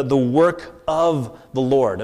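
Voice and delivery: "of" stretched out slowly; slow down and lean on the word "of"; stress on of. Shown as a demonstration. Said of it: "I want to emphasize the word".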